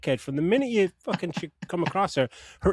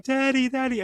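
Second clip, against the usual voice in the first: put-on high-pitched child calling Daddy; imitated little girl; 9 semitones higher than usual. Girl in the water calling her dad, mock childlike. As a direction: lightly mimic a kid calling out. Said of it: "walks into the water at one point going".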